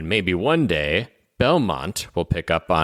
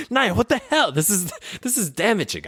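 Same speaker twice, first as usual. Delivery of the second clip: high-pitched, laughing through the words; laughing protest; 13 semitones higher than usual. Real, not acted. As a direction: protest while cracking up.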